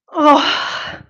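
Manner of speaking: loud groan of relief after a hard set; big post-set "oh"; effort groan. Real, not acted. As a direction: groan it out as the weight drops.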